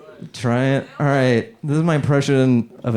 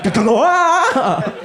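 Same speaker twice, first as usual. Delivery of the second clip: mock thrilled scream; 13 semitones higher, 4 dB louder than usual. Dying rider on roller coaster.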